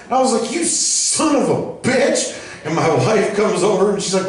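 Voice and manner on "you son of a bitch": high, drawn-out mock outrage; hammed-up exasperation, pitched high; indignant retold curse. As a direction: slowly stretch out each word of the curse. Retold curse at the splashing kid.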